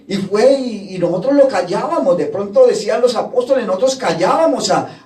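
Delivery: ordinary talk, nothing marked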